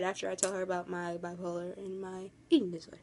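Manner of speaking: hushed unvoiced confession; whisper. Real, not acted. Vlogger confiding her illness to viewers in a whisper. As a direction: breathe it out, barely there.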